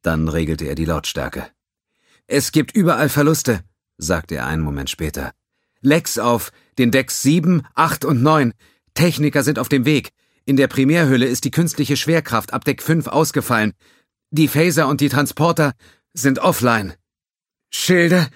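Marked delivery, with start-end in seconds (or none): 2.3-16.9 higher, louder tense report; urgent young officer. Acted. 17.7-18.3 urgent question. Acted. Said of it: "fragte Katami".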